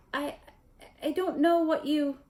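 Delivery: high and halting with fear; Quirrell stammering. A narrator's reading. Quirrell cornered by Snape, frightened stammer. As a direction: plead and trip over the words.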